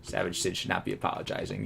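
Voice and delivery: normal talking voice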